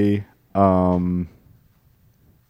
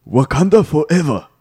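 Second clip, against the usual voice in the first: raised, quick Wakandan catchphrase; Wakandan accent; 4 semitones higher, faster than usual. Pretend. Student trying the teacher's catchphrase, Wakandan accent. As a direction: try out a Wakandan battle cry. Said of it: "[name], give it a try".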